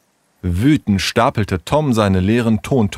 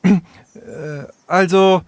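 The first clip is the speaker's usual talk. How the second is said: halting, higher-pitched false start; hesitant start; 9 semitones higher than usual. A narrator's reading. Sarah starting her apology to the gang, hesitant. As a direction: stall before an awkward apology. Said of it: "Dann begann sie noch einmal".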